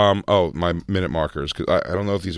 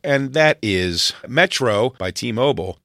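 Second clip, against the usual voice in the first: ad read voice; 7 semitones higher than usual. Acted.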